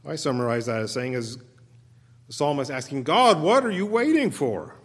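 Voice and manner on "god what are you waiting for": exasperated plea. Acted. Voicing psalmist's frustration.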